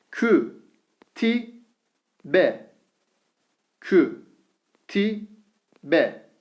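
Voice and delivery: Vü said with thin Ü, each syllable drawn out; syllable drill with thin vowel. Shown as a demonstration. Teacher reads the thin-vowel syllables to students, slowly. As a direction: read out each syllable apart. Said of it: "Ü sesi veriyoruz".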